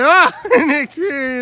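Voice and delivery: loud laughing yell; laughing shout. Real, not acted. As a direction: burst out laughing. Surprise laugh during commentary.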